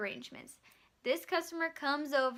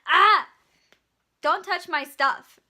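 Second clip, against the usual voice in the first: loud startled shout at the bagger; angry customer yell; 5 semitones higher, 13 dB louder, slower than usual. Retold or imitated. Customer yelling at the bagger not to touch. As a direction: bark it at the girl reaching for his groceries. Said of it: "and he goes".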